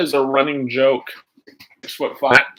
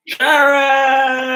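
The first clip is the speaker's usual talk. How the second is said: high, loud call across the room; 11 semitones higher, 5 dB louder than usual. Real, not acted. Streamer calls out to Sharon, loud and high. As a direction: call her name out loud. Calling out a name.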